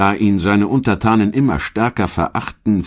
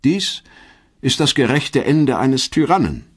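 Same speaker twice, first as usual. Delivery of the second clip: higher, lightly voiced Cicero; Cicero's verdict; 8 semitones higher than usual. Cicero writing about Caesar's death, lightly voiced verdict. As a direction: pronounce it like a stern judgment. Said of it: "wird später Cicero schreiben".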